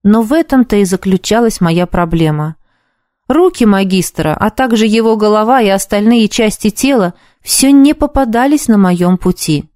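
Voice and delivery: ordinary delivery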